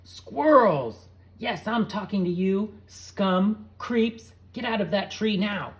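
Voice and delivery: loud, high furious yell at squirrels; angry yelling. A narrator's reading. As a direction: scream them out of the tree. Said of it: "began yelling".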